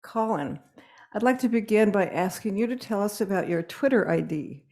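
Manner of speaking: usual speaking voice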